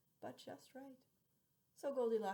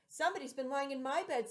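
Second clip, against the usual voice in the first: louder mama bear voice; mama bear, firm and raised; middle-sized bear voice; 5 semitones higher, 10 dB louder than usual. Acted. Mama bear noticing her spoon, raised voice. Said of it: "in a middle voice".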